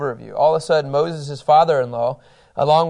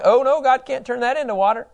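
high, mock-worried voice of the grumbling crowd; mock doubting whine; 9 semitones higher than usual. Teacher mocks the Israelites doubting God at the rock, whiny. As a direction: play the doubters, scoffing.

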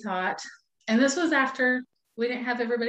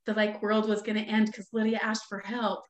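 usual speaking voice

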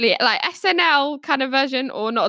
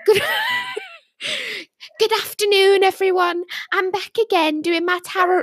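higher-pitched Yorkshire livestream psychic; Yorkshire accent impression; 6 semitones higher than usual. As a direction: greet viewers in a put-on Yorkshire accent. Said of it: "She's got it".

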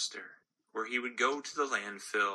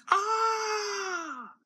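cry of surprise; 4 dB louder than usual. Acted. Gumball drops down the chute.

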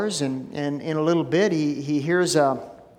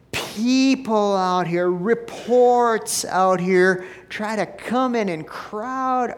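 "out here" hit higher and slowly each time; stressed out here; 5 semitones higher, slower than usual. Real, not acted. Pastor warning the church, slow and emphatic on "out here". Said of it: "preaching emphasis".

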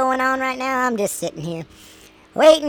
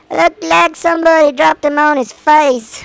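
loud, high hammed-up reaction; mock dismay; 6 semitones higher, 8 dB louder than usual. Parent reacting to the newborn, loud mock dismay.

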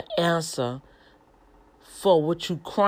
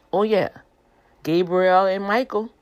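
ordinary delivery

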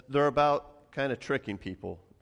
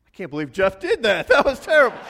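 mock outrage; 10 semitones higher, 12 dB louder, faster than usual. Dad fakes outrage at Jeff.

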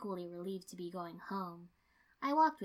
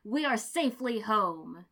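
slow, much louder proclamation; loud declaration; 2 semitones higher, 9 dB louder than usual. A narrator's reading. Guy Sensei tells everyone they are home, loud and slow. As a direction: announce it broadly and proudly.

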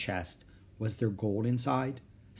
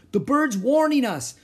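high, loud, urgent cry; alarmed shout; 13 semitones higher, 8 dB louder than usual. A narrator's reading. Crewman urging escape from the storm, shouting. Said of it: "shouted Pinky".

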